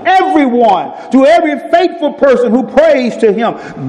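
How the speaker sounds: high, ringing preacher voice acting out Jesus and Peter; preaching at full force. Preacher retelling Peter on the water, fervent and high.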